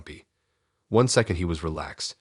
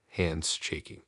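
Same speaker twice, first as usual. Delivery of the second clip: ordinary delivery, nothing marked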